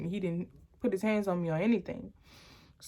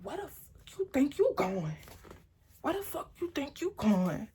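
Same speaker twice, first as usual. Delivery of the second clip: put-on higher, threatening quote of the boyfriend; menacing boyfriend; 6 semitones higher, 3 dB quieter than usual. Boyfriend with a knife threatening her as she packs. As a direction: voice him demanding where she's going. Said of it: "He was like".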